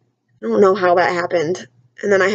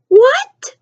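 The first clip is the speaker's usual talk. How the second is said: much higher, louder rising what; incredulous shout; 6 dB louder than usual. Retold or imitated. The family at dinner, shouting in disbelief at the sister. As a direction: blurt it out in disbelief. Family reacting to odd word.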